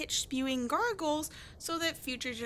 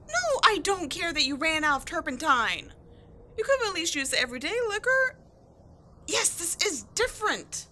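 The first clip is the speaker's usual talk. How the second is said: annoyed arguing; 4 semitones higher, 6 dB louder than usual. Acted. Arguing about the liquor.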